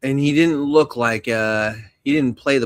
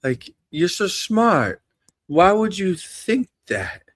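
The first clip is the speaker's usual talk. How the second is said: high, slow disbelieving drawl; high and slow, mock disbelief; incredulous no; 6 semitones higher, much slower than usual. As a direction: act baffled that someone so smart believes this. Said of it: "And I was just like".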